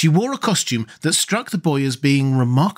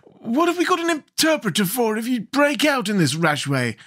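high-pitched, chiding Scarecrow king; 12 semitones higher than usual. Acted. Scarecrow reproves rash Jack, high and chiding. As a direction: scold lightly in a high character voice.

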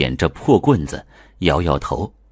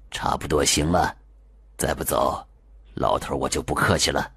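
low, softer, stern threat; low stern warning; 3 semitones lower, 6 dB quieter than usual. Acted. Liu warns the lingering ghost, low and stern. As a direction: warn the ghost quietly, firmly.

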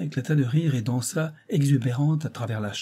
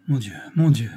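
louder, sighing lament; sighing despair; 6 dB louder than usual. A narrator's reading. Financier collapsing on a chair, sighing in despair. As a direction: sigh it out in dismay. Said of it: "soupira Saint-Renaud".

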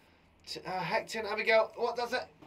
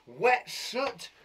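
ordinary talk, nothing marked

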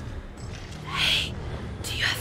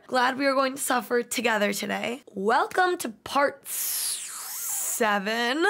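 ordinary talk, nothing marked

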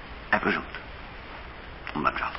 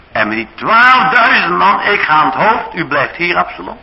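1 hint of a boastful counsellor; 6 semitones higher, 5 dB louder than usual. Ahithophel boasting his counsel.

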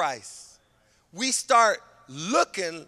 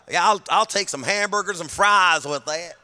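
Texas twang; 4 dB louder than usual. Acted. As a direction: slip into an exaggerated Texas accent. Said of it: "talk like a Texas twang".

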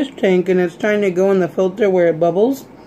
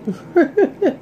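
high giggle; 11 semitones higher than usual. Real, not acted. Laughs at the cute cans.

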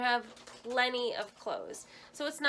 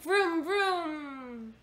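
playful car vroom, high; airplane spoon game; 6 semitones higher, 4 dB louder than usual. Shown as a demonstration. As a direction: make a car noise for the baby. Car noise for toddler.

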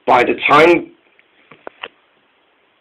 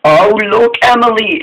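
raised, cutting-in call; interrupting; 7 semitones higher than usual. A narrator's reading. Neville cutting in on Emily, interrupting. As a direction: break in with a higher voice. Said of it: "interrupted Neville".

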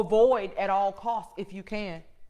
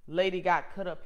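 usual speaking voice

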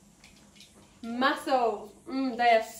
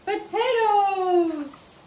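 stretched out, high and loud praise; excited praise; 6 semitones higher, 6 dB louder, much slower than usual. Real, not acted. Praises child's answer.

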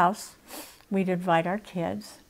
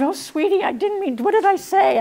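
comforting nun; 11 semitones higher, 4 dB louder than usual. Retold or imitated. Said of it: "and she said".

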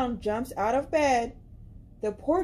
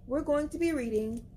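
usual speaking voice

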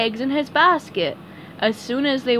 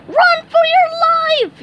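very high, loud panicked yell; SpongeBob yelling; 9 dB louder than usual. A narrator's reading. SpongeBob flees a giant fish with Patrick, yelling. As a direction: scream it as an alarm. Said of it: "yelled SpongeBob".

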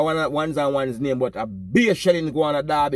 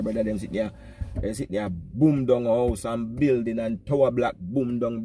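ordinary talk, nothing marked

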